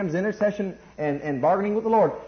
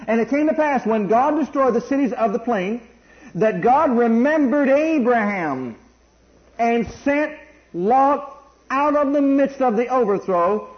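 slow, measured verse read, a bit higher; scripture reading; 5 semitones higher, 4 dB louder than usual. Acted. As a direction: lift the verse out slowly. Reads the verse aloud.

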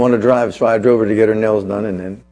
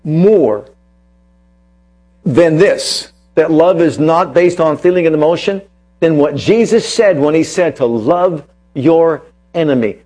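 raised, emphatic sermon voice, punching key words; preaching; 3 semitones higher, 3 dB louder than usual. Acted. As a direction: drive the point home to the congregation. Preacher builds his point.

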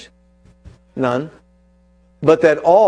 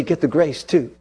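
usual speaking voice

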